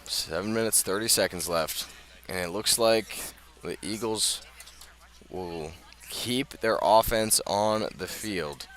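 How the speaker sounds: ordinary talk, nothing marked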